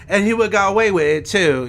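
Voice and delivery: a light put-on British accent quoting Randy. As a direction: ham up a British villain's gloat. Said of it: "when he does the British ac".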